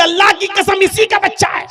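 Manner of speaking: high, loud swearing; woman shouting her oath to the doctor; shouted oath. Acted. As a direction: swear it at the top of your voice.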